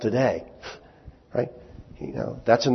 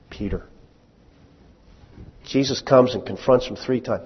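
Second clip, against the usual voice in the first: normal talking voice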